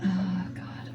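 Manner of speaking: low whispered aside; whispered dismay. Acted. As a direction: whisper to yourself, stuck for an answer. Inner grammar panic in bed.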